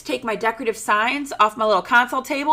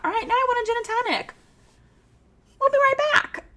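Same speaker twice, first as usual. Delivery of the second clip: much higher, fast cheery break; playful high sign-off; 13 semitones higher than usual. Pretend. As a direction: chirp it like a radio break.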